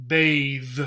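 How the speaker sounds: bathe. con th final sonora, vibrando; voiced th. Shown as a demonstration. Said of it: "the T H changes from this sound to this sound".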